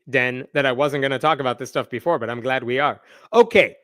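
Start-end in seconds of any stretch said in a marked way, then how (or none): none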